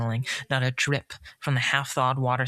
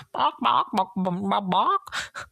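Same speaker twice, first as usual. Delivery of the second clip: chicken squawk; 8 semitones higher, slower than usual. Acted. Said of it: "began to squawk wildly".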